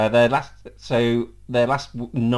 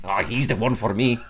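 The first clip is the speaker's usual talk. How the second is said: hint of a hammy Mexican accent; Mexican bandit accent; 3 semitones lower than usual. Podcaster imagining [name] falling for him, in a mock Mexican accent. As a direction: put on a light cartoon Mexican accent. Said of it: "playfully be described as a Mexican bandit".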